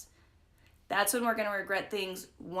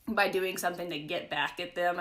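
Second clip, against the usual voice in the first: usual speaking voice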